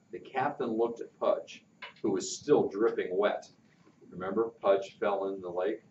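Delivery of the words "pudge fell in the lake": ordinary delivery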